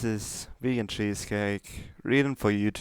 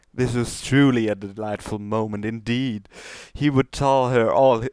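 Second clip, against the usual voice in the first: usual speaking voice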